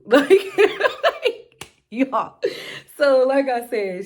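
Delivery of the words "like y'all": loud, high burst of laughter; real laugh. Real, not acted. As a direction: crack up mid-story. Laughing at her irritation.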